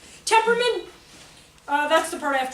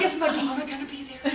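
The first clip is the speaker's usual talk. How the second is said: usual speaking voice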